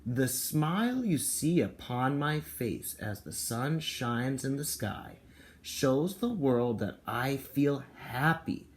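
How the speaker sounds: slow, cheerful storybook reading; happy read-aloud voice; reading to children. Acted. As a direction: read it slowly, beaming, to little kids. Reading the picture book aloud.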